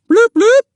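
very high, loud imitated siren bloops; police siren blips. A narrator's reading. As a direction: mimic a police car's electronic beeps. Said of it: "it beeped its electronic siren at them".

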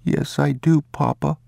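put-on high child's voice repeating; little boy. The boy repeats the words his father gave him, in a high child's voice. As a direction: echo it like an obedient boy.

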